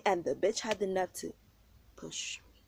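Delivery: ordinary delivery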